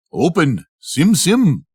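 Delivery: a hint of a hopeful first try; trying the spell. Ali Baba testing the captain's words on the rock, lightly hopeful.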